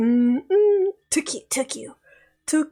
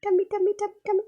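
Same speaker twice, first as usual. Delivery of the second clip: cooing; 6 semitones higher, much faster than usual. Real, not acted. Cooing over cute character art.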